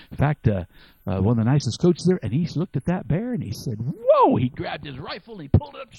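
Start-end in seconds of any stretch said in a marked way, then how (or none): 3.8-4.4 high, loud startled cry; surprised whoa. Retold or imitated. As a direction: jump back at the sight of the bear. Hunter startled by the bear.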